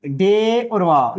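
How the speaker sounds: letters named very slowly; slow, careful spelling for students; slow letter naming. Shown as a demonstration. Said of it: "अगर हम लिखते हैं".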